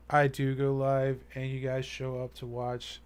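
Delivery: ordinary delivery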